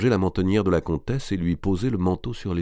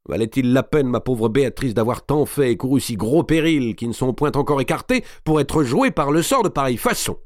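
bitter complaint; 5 semitones higher than usual. Acted. Mao raging to Béatrice.

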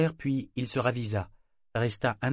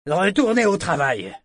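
sharp bark, much louder and higher; curt shout; 8 semitones higher, 10 dB louder than usual. Acted. Captain ordering gawking sailors back, curt shout. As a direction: send them off in one snap. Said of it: "leur cria-t-il sèchement".

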